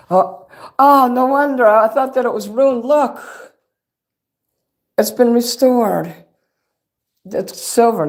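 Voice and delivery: sudden thrilled realization, voice jumping up; excited surprise. Real, not acted. Discovers the plaque is silver.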